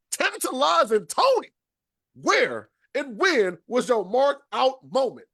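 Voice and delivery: hyped announcer question; host hype. Real, not acted. Host calls out the big question.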